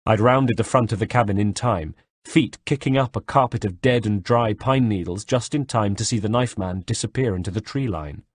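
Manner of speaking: ordinary talk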